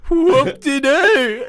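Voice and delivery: high, drawn-out mocking "Whoop-de-doo"; sarcastic whoop-de-doo. Cheeky kid taunting the teacher over strikes, sarcastic. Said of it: "I'm like".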